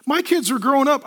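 ordinary delivery

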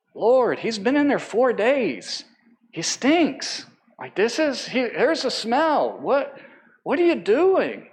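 lightly voiced Martha, incredulous at the order; Martha protesting. Martha objects to opening the tomb, incredulous.

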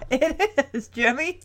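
ordinary talk

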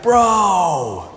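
lightly voiced laid-back snowboarder dude; funny surfer-dude talk. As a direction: drawl it like a chill ski-hill bro. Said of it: "Why are you talking so funny?".

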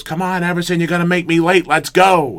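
high, increasingly loud mock yell; retold screaming. Coach hurries player on game day, high retold yelling. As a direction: imitate a coach yelling to hurry up. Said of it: "He's screaming at me".

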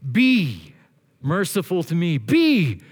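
"Be" punched loud and set apart by pauses; a forceful, stand-alone "Be"; stress on BE. Shown as a demonstration. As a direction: hit "Be" hard, then ease off. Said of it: "emphasize a different word".

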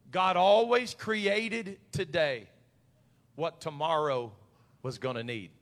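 slow, emphatic preacher's declaration; very slowly. Real, not acted. Preacher to congregation so it gets in their spirit, very slowly. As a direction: draw each phrase out, letting it settle. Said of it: "I'm gonna say this very slowly".

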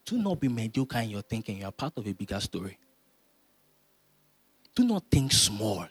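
normal talking voice

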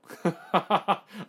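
high-pitched burst of laughter. Real, not acted.

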